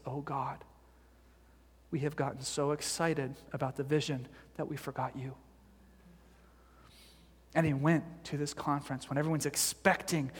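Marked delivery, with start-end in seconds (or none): 0.1-5.3 hushed prayer. Retold or imitated. Realization before God after vision.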